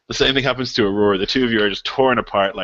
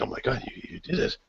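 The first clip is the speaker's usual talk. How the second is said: much softer amazed little voice; wrong NPC voice; 10 dB quieter than usual. Acted. DM as pleased quest givers greets returning players, soft amazed voice. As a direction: gasp it very softly in amazement. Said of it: "Wait, that's not their voice".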